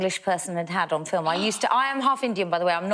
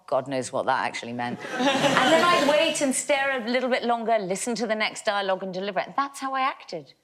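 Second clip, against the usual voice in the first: ordinary delivery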